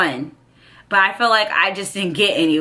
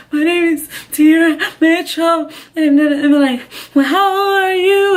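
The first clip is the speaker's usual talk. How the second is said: high, louder dazed reply; dazed answers; 9 semitones higher, 5 dB louder than usual. Retold or imitated. Injured girl answering paramedics in a high dazed voice. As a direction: answer the medics in a daze.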